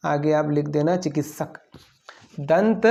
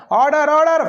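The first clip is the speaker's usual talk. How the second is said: higher, louder mock judge banging for order; judge calling order; 8 semitones higher, 4 dB louder than usual. Teacher playing a judge for the class, mock order call. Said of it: "क्या बोलते हैं? जज साहब".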